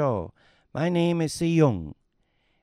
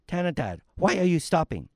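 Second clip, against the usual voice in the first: normal talking voice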